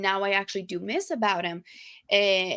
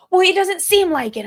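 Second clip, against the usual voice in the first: higher, louder snappy comeback; mock indignant retort; 10 semitones higher, 9 dB louder than usual. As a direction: throw it back at him, hurt. Arguing with boyfriend.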